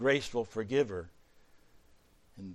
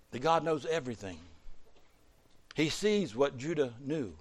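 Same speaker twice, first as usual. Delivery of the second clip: ordinary delivery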